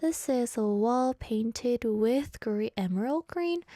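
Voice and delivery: slow, drawn-out laboured reading; slow-reader impression. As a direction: act out plodding through a sentence word by word. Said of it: "this other phase where I was like".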